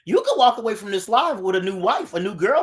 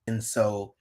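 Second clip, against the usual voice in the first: ordinary delivery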